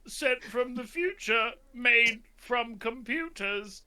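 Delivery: high, slow robot voice; toy robot voice. Acted. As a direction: talk like a cheap robot. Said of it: "transferred his consciousness into a robot".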